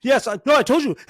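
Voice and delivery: hammed-up heated retort at a teen; a mock frantic parent matching a teenager's pitch; escalated teen-matching voice. Shown as a demonstration. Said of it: "But if I'm like".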